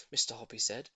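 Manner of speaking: ordinary delivery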